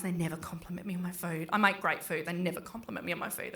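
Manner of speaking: quick, soft grumbled aside; muttered aside. Real, not acted. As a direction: grumble it under your breath.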